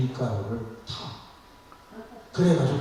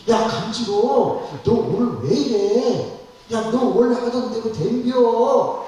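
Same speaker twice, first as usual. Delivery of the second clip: baffled husband; 7 semitones higher, 4 dB louder than usual. Retold or imitated. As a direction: drag out each puzzled question. Baffled husband questions his wife.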